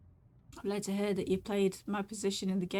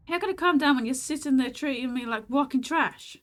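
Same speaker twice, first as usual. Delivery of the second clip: louder, higher furious outburst; angry shout; 4 semitones higher, 7 dB louder than usual. Acted. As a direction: blow up at them. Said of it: "I'm not actually gonna shout that".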